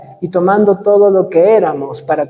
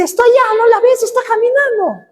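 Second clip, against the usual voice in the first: church sister; 13 semitones higher than usual. Retold or imitated. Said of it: "una hermana dice".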